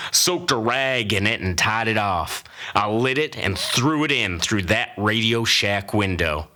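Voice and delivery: normal talking voice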